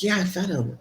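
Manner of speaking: lightly acted woeful reply; imitated troubled inmate. Inmate answering about imaginary baby.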